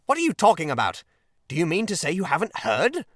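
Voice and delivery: high, loud incredulous questioning; greatly excited. A narrator's reading. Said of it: "greatly excited".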